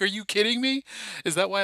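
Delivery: high, loud, laughing disbelief; loud high shout of amazed disbelief; disbelieving shout. Retold or imitated. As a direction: yell it as if you can't believe the news. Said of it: "I literally lost it".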